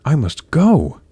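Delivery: urgent storybook exclamation; urgent leave-taking. A narrator's reading. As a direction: insist that you have to leave. Cinderella fleeing as midnight strikes.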